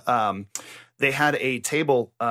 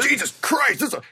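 mock strained Batman; 8 semitones higher than usual. Straining at heavy table.

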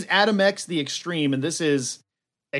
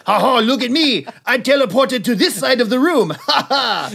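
loud, higher gloating showoff; Nightcrawler; 5 semitones higher, 4 dB louder than usual. Acted. Nightcrawler boasts he teleported across the room, loud gloating. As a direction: crow triumphantly with big fake laughs. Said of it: "And he's like".

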